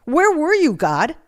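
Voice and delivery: high, loud, angry demand; furious full-voiced accusation; screaming at God. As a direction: shout the question up at God. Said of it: "I just kept screaming at him".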